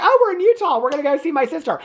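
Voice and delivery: very high, quick imitation of her delight; mock delighted realization. Heroine realizes she can visit sister, mock delight. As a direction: play her chirping at the news.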